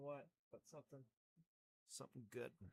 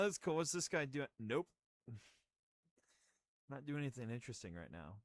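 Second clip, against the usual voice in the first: ordinary delivery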